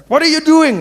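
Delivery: high, loud police challenge; police barking. Retold or imitated. Police bursting in on mixed couple, loud bark. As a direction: bark it like a raiding cop. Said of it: "be like".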